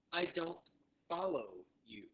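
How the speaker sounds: deliberate repeat-after-me phrasing; slow teaching model. Shown as a demonstration. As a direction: break "I don't follow you" into separate words for learners. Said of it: "So say that with me".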